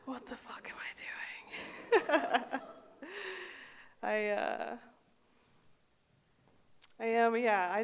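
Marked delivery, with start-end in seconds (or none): 0.1-2.6 slow laughing whisper; slow whispered disbelief with a laugh. Real, not acted. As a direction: mutter it to yourself, slowly. Self-aware whispered aside.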